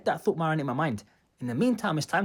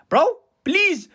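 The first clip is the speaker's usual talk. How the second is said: high, loud mock begging; acted exasperated appeal; exasperated plea; 10 semitones higher, 6 dB louder than usual. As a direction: plead with them, pitched right up. Begging the group to stop.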